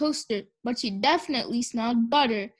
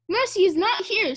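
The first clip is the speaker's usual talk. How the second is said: high, worried voice; alarmed report; 7 semitones higher, 4 dB louder than usual. A narrator's reading. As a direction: break worrying news to a husband. Wife alarmed the pig is missing.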